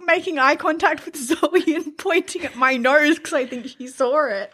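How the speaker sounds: high, giggly and breathless with laughter; barely holding in laughter; laughing. Real, not acted. Explaining fly, still laughing.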